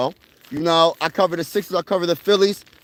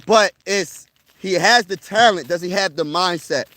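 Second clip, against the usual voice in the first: ordinary talk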